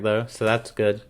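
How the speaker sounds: ordinary delivery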